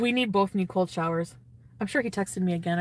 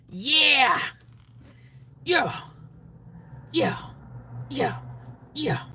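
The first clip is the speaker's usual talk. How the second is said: cheering; 5 semitones higher, much slower than usual. Real, not acted. Cheering herself on.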